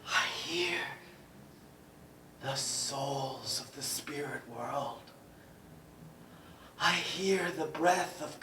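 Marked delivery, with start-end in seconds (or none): 0.1-5.0 whispering. Acted. Eerie hushed line.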